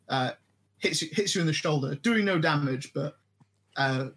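ordinary delivery

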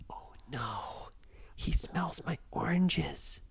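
slow, worried whisper; boy whispering from behind the rock; scared whisper. Acted. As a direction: breathe it out, terrified of being found. Brady hiding from giant.

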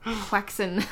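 "Quaxin" said as "kwaksen"; Quaxin pronunciation. Retold or imitated. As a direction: mispronounce Croissant as Quaxin.

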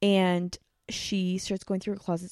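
ordinary talk

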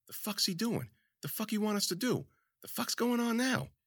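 high, hushed, baffled murmurs; crowd whispers. A narrator's reading. Confused audience members whispering among themselves, hushed. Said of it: "Whispers in the crowd".

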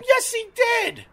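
very high, insistent comeback; retold contradiction. Insisting to the owner his dog attacked, mock insistence. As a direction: fire back high, as in a childish squabble. Retold back-and-forth quarrel.

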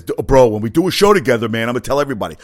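a touch of overexcited buddy; mock eager promise. Host mimics friend promising to plug the show, mock eager. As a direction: imitate a hyped-up bro, starting loud.

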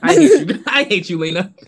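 loud, high playful I hate you; playful outrage. Pretend. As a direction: fake outrage at a friend. Mock outrage at friend.

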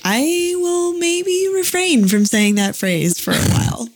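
high, drawn-out "I", then careful backpedaling; hesitant vow. Retold or imitated. Quoting friend after her house burned, cautious drawn-out vow. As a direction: stretch it out high, as if reluctantly conceding.

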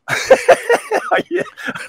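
sudden high laugh; high burst of laughter. Real, not acted. Host cracks up at puppet.

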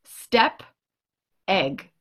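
usual speaking voice

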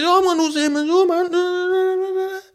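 very high, fast, sing-song bluff; squeaky bravado. Retold or imitated. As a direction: squeak out a brave lie. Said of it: "I said".

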